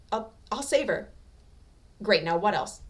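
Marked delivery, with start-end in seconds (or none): 0.1-1.1 stammering promise. A narrator's reading.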